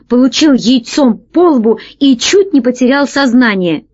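plain reading voice

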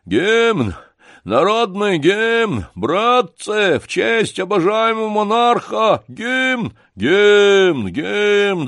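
high, loud rabble-rousing cry; shouting. Acted. Гундосый to the tavern audience, demanding the anthem, shouting. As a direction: yell the demand out to the whole crowd. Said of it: "крикнул".

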